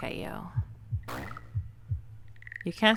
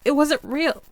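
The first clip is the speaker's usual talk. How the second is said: higher, louder, fast protest; heated denial; 6 semitones higher, 5 dB louder than usual. Acted. Arguing with the ghostly partner, loud heated denial. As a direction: snap back defensively.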